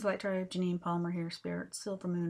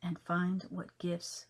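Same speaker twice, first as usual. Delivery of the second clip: measured poetry reading with long pauses between phrases; poem recitation; 2 semitones higher, 5 dB louder than usual. Acted. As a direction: recite it line by line, punching openings, letting endings settle. Reads her own poem aloud.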